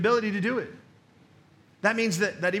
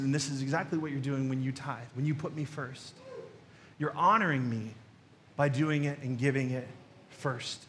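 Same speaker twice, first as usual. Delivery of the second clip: softly spoken; 5 semitones lower, 5 dB quieter than usual. Retold or imitated.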